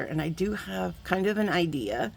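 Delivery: usual speaking voice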